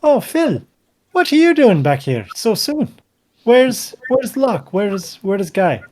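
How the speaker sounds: high, bright surprised woman's voice; Hilta's greeting. Acted. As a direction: voice her high and startled. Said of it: "she looks straight back at you and is like".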